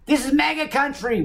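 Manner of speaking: high mock proclamation; mocking imitation. Mocking the attackers' supposed shout.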